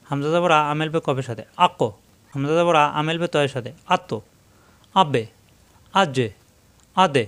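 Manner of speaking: আকো আতো said with a little knock on the final letter; echoing qalqala knock; bouncing qalqala. Shown as a demonstration. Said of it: "সাকিনে আসলে ধাক্কা হবে".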